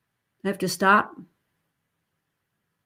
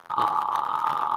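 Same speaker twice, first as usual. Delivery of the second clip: creaky open "ah"; open vocal fry; 6 dB quieter than usual. Shown as a demonstration. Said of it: "we've got the open fry".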